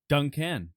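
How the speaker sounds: "Duncan" stressed on the wrong syllable, the second; odd emphasis on Duncan. Shown as a demonstration. Host calling on Duncan, stress thrown onto the wrong syllable. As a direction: say "Duncan" as dun-CAN. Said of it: "on the wrong syllable".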